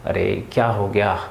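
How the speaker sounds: low, soft, weary sighing voice; tired sigh. Shown as a demonstration. Teacher acting out a tired sigh for children, low and weary. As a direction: let it out like you're worn out. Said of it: "इस तरीके से हम expression देते हैं".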